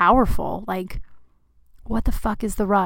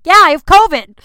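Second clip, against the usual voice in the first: much higher and louder, a put-on cartoonish voice; mock exasperated retort; 9 semitones higher, 15 dB louder than usual. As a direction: blurt it out in an over-the-top mock voice. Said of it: "and I was like".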